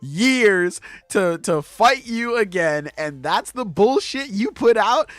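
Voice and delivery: high, loud furious quote; angry rant. Retold or imitated. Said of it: "She's like".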